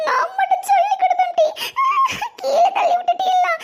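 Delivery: high-pitched wailing complaint through sobs; crying. Acted. Pushed-down pupil protesting to classmates, crying. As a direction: wail it out like a schoolchild in tears. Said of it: "கீழே கிடந்து அழுதுட்டு கிடக்காத".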